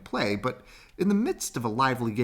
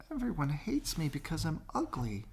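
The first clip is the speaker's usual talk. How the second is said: higher, softer sad little voice; sad duckling thought; 6 semitones higher, 6 dB quieter than usual. Acted. Duckling on the pond, softly and sadly thinking. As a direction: say it like a hurt child. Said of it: "he thought".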